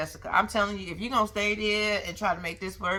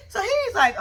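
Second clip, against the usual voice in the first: laughing while telling; 13 semitones higher, 5 dB louder than usual. Real, not acted.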